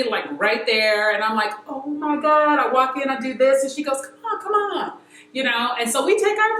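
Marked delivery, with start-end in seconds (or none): 1.7-2.6 drawn-out, higher-pitched starstruck gasp of words; mock freak-out. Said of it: "I am freaking out". 4.0-5.0 high-pitched imitation of the singer; beckoning singer. Singer beckons a starstruck fan.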